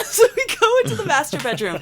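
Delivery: high, fast words through laughter; laughing. Real, not acted. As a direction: crack up while telling it. Laughing while telling the story.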